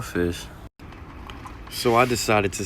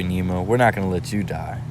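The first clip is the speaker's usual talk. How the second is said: ordinary talk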